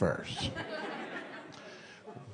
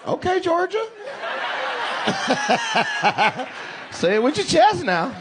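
high, laughing call-out; laughing tease; 13 semitones higher than usual. Real, not acted. Comic teasing the man from Georgia, high laughing. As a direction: egg him on with a laugh. Mocking a Southern "no sir.

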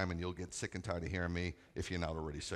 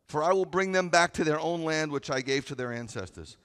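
loud, high preaching of the verse; full-force pulpit reading; preaching scripture; 7 semitones higher, 8 dB louder than usual. Acted. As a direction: proclaim it to the congregation.

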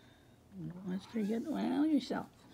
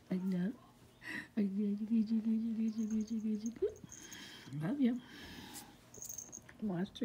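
ordinary talk, nothing marked

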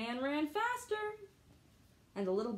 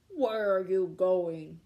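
slow, loud bear bellow; shouted bear; 3 semitones lower, 6 dB louder, much slower than usual. Acted. Bear shouting at the gingerbread man, slow bellow. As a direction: boom it out like a big bear. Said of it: "shouted the bear".

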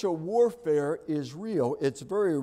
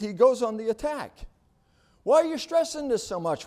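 raised, loud preaching build; preaching at full force; 6 semitones higher, 4 dB louder than usual. Acted. Pastor warning the church of spiritual warfare, full-force preaching. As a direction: drive the warning home from the pulpit.

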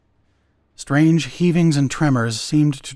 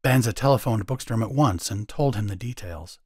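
ordinary delivery, nothing marked

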